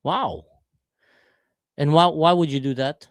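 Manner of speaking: usual speaking voice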